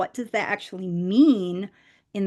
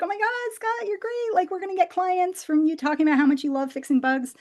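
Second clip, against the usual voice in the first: high, gushing mock excitement; mock gushing praise; 10 semitones higher than usual. Wife mimicking her podcast excitement, high and gushing. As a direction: play the starstruck fan of your own husband.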